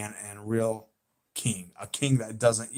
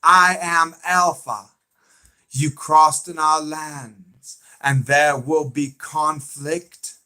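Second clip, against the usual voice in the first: slow, raised impression on request; Alpha; 4 semitones higher, 5 dB louder, slower than usual. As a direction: stretch each word out, imitating the villain's declaration. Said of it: "Okay, I'll do it".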